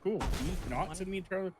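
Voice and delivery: high, exasperated sarcasm; sarcastic complaint. Retold or imitated. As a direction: sarcastically ask the game. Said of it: "I'm just like".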